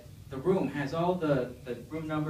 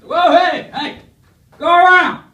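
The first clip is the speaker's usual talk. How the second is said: yelled way up high and loud; 22 dB louder, slower than usual. Retold or imitated. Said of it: "loud redneck just shouting at me".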